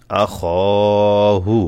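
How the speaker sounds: short "আ", long "খ", short "হু"; madd stretch. Shown as a demonstration. Teacher reading practice words for learners, stretched middle "খ". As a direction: sustain only the "খ" in "আ খ হু". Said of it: "এখানে একটা টান আছে".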